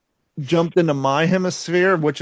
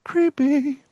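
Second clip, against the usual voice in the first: high creepy voice; 9 semitones higher, much slower than usual. Acted. Spam call joke, high voice.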